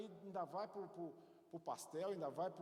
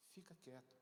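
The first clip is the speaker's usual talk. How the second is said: hushed; 3 semitones lower, 9 dB quieter than usual. Shown as a demonstration.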